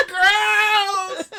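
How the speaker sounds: high, loud, drawn-out comic cry; mock disgusted wail. Boy recoiling from the girl, hammed-up high wail.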